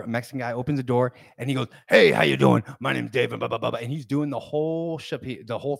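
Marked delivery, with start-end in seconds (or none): 1.9-3.8 big loud "hey," then patter; loud salesman spiel. Retold or imitated. Salesman greeting a man at his door, loud and expressive. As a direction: punch the greeting like a pushy salesman. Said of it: "he's always talking like this".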